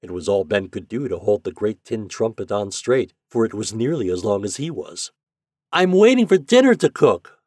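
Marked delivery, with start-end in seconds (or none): 5.7-7.4 put-on high, loud boyish holler; child calling out at full voice; boy shouting. A narrator's reading. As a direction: shout it through a megaphone to a distant boat. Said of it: "the boy shouted again".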